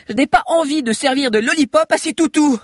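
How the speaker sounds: high, loud voiced girl; high, projected mock apology; defiant girl. A narrator's reading. Girl defies dogs before jumping.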